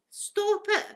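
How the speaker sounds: sharp high self-scolding. Retold or imitated. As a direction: snap at yourself to stop crying. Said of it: "I was saying to my own head".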